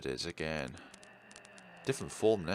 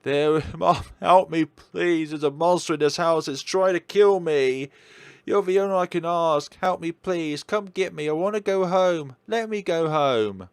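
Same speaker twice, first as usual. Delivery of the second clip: loud, higher, desperate begging; panicked pleading; 6 semitones higher, 8 dB louder than usual. Acted. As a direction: beg mom to come get you. Daughter begging mother on phone.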